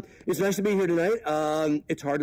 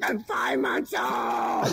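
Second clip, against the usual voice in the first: high, very slow protesting shout; mock baby yell; 5 semitones higher, much slower than usual. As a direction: wail it back as a falling baby in slow motion. Baby's retort while falling.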